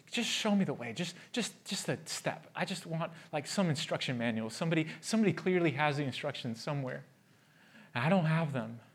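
soft, pleading, half-whispered; pleading for direction. Retold or imitated. Lost believer asking for instructions, hushed and pleading. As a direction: beg quietly for a way forward. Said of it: "we're groping for just".

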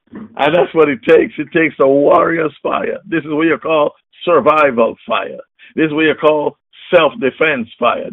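ordinary delivery

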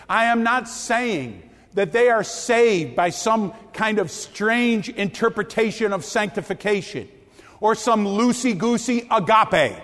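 usual speaking voice

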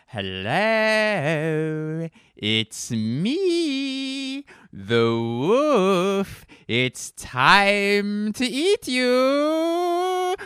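high, loud, drawn-out howl; hammed-up wolf taunt, slow and swooping; wolf impression. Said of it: "here's my impression of".

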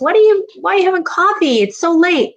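high-pitched, questioning mock husband; imitated husband. Wife quoting husband about late coffee, mock fussing. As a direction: mimic a spouse's fussing, high and quick. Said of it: "My husband usually says".

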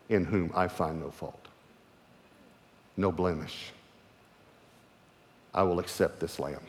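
a quiet blessing from the Father; gentle fatherly voice. Retold or imitated. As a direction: speak it like a loving father. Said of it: "This is the way he said it".

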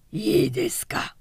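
high, husky call for attention; straining hoarse voice. A narrator's reading. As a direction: force out a rasping, breathy question. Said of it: "大六はしゃがれ声を励ましていった". (しゃがれ声を張る)

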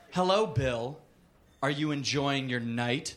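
low, measured, ominous hello; coolly confronting him; cool menacing greeting. A narrator's reading. Accuser confronts him at the door.

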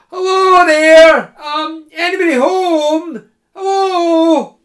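high, loud calls at the gate; calling out. Acted. The students calling at the gate, high and loud. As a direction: shout over the creaky gate. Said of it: "cried out".

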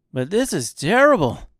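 high, loud protest; dismayed. A narrator's reading. Tin woodman learning Oz is a fraud, dismayed. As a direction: cry it out in dismay.

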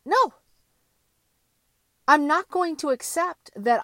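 sharp, loud refusal; defiant no. Real, not acted. Refusing to accept a resigned life, a loud defiant no. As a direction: cut it off hard.

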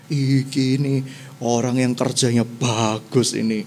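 low, pleased king's voice; a king boasting about his best man, voiced low; proud king. King praising Naaman to himself, low and pleased.

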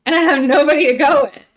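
loud, high-pitched exaggerated wail; mock-distraught outburst. Abandoned student protesting to doctors, mock-distraught. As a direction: blurt it out as a hammed-up complaint. Retold complaint at being abandoned.

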